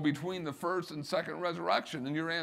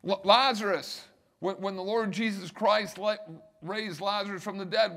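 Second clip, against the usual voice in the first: ordinary talk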